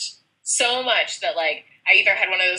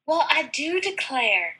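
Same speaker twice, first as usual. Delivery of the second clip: slow, higher-pitched southern drawl; southern accent; 7 semitones higher, 3 dB quieter, slower than usual. Acted. Answering her friend's wondering about a southern accent, southern drawl. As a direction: put on a Southern belle accent. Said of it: "busted out my southern".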